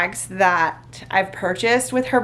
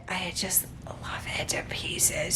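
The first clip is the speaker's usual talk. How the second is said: soft, adoring whisper; 3 semitones lower, 13 dB quieter than usual. Real, not acted. Whispering her love for the new bag. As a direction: breathe it like a secret.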